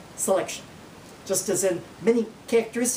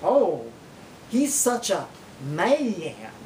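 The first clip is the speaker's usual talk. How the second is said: lower, swooning drawl; imagined corn-fed mama; 4 semitones lower, much slower than usual. Acted. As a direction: drawl it admiringly, stretching "man" out. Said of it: "some corn-fed mamas".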